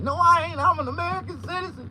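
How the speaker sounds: high, loud indignant cry; indignant protest. Retold or imitated. Insisting he's American, high and loud. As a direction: shout your citizenship back. Protesting being called Mexican.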